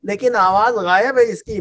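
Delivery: ordinary delivery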